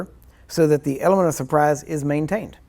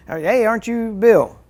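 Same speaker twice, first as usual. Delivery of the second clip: eager stranger; 6 semitones higher, 4 dB louder, slower than usual. Pretend. Stranger mistakes you for Bill.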